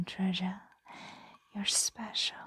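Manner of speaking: normal talking voice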